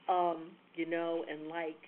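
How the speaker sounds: drawn-out slow um, you know; hesitant filler sounds, stretched slowly; filler words. Shown as a demonstration. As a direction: drag the fillers out as a bad example. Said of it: "Avoid filler words like".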